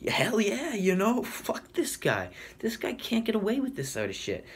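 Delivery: high-pitched, worked-up mock yell; imitated yelling. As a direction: yell along with an angry crowd, held back in volume. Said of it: "yelling at each other, screaming".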